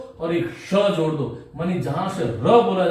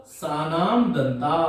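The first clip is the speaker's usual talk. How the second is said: slow dictation; 3 semitones lower, much slower than usual. Shown as a demonstration.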